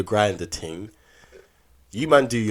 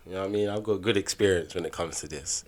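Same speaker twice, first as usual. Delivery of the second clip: ordinary delivery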